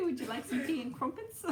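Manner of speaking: high, bad British accent; badly faked British tea-time politeness; attempted British accent. Shown as a demonstration. Streamer asked to try an accent, badly faked British accent. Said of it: "Try a British accent".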